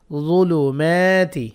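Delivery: "যুলুমাতি" slowly, with a long "মা"; drawn-out "যুলুমাতি", aa vowel held; one-alif lengthening. Shown as a demonstration. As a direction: stretch the "মা" in "যুলুমাতি". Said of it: "মিম এক আলিফ টানবো".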